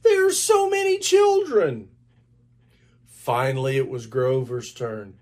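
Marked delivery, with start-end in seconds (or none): 0.0-1.9 high, loud, amazed monster voice; Grover to himself. A narrator's reading. Grover amazed at the children, high and loud. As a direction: gasp it out up high. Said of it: "Grover said to himself".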